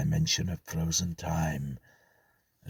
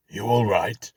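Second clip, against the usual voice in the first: loud, raised call down into the hole; 4 semitones higher, 8 dB louder than usual. Acted. Conway shouting down the hole to Drayton, loud. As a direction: call down to a man buried in rubble.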